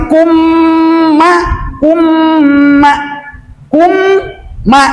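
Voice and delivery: KUMMA high, humming the m then snapping onto ma; held m, punched ma. Shown as a demonstration. Teacher drills the doubled letter, held then punched. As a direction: hold the double m of kumma and hit ma. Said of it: "kenapa maknya dibentak".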